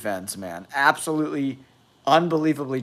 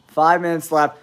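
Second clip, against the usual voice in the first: ordinary talk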